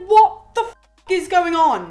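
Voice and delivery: loud, high burst of mock disbelief; acted outrage. Neptune sees a catastrophe in front of him, mock outraged outburst.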